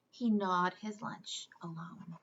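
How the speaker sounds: plain reading voice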